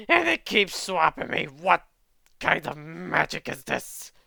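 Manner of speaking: exasperated alien complaining; annoyed alien. Acted. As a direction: grumble like a trapped creature.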